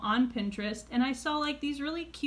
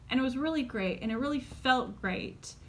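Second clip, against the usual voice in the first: ordinary delivery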